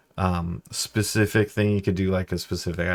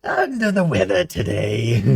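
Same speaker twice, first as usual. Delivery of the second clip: high whispery crazed rasp that swells; crazy Junkrat, much higher; 9 semitones higher than usual. Retold or imitated. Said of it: "sort of a Junkrat voice for crazy people".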